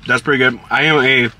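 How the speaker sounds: speaking between chews; talking while chewing. Real, not acted. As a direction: mouth partly full of food. Seen 2: Bites burger at -1.0, then talks with jaw working on food.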